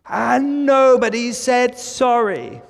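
mock-irate customer, high, loud and drawn-out; loud, high-pitched hammed-up complaint, stretched slowly; imitated telling-off. Imitating an angry customer having a go at staff, mock-outraged. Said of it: "finish their diatribe having a go at".

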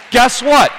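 high, loud "Guess what?" shouted out; preacher shouting a rhetorical question. Acted. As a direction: fling it at the room as a shout.

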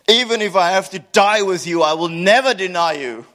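emphatic vow. Acted. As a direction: swear it with all your heart. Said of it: "Peter declared emphatically".

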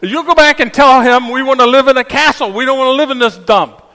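demanding wife. Retold or imitated. Wife ordering her husband back to the fish, loud and high. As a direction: shout the demand like a greedy wife.

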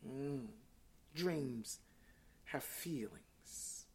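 very soft and low, fading into a whisper; near-whispered reflection with pauses; hushed aside. Real, not acted. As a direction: drop right down, slow and confiding. Drops to hush mid-sermon.

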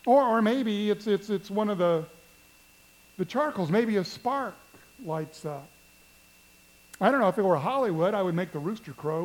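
ordinary talk

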